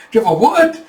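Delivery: high mock disbelief, rising; incredulous shock. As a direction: shoot up high on the final word. Shocked reaction to sexy man remark.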